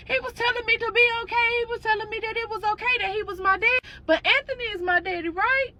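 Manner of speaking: put-on high, fast little-boy voice; young son. Son asking his mother, high and fast. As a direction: rush it high like an upset child. Son keeps telling mom.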